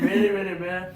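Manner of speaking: dismissive babble; mocking blather. Retelling what Sam said Boston complained about, in loud mock blather. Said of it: "He's like".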